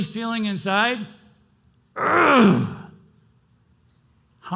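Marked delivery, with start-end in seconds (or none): 2.0-2.8 sudden loud anguished groan, high; a raw cry of frustration. Acted. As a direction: let the pain burst out in one cry. Venting what he really feels inside.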